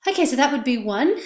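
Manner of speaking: ordinary talk, nothing marked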